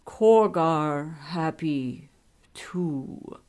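slow, low dim brute, fading out; a simple oaf speaking word by word; Korgar. A narrator's reading. Korgar speaks through Wizard King.

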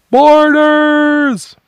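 high, loud alarm cry; shouted alarm. A narrator's reading. Sailor raising the alarm on deck, a high loud shout. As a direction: yell it across the deck to raise the alarm. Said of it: "the shout went up".

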